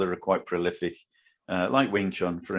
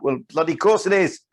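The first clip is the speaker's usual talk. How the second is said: higher, louder emphatic comeback; exasperated retort; 8 semitones higher, 5 dB louder than usual. Acted. Martial artist answering imagined doubters, exasperated retort. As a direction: fire back, raised in pitch and volume.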